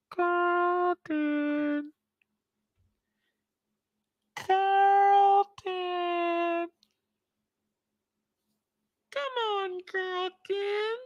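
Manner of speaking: very high, eerie falsetto calling Carlton; a tempting drug voice calling from the drawer. Acted.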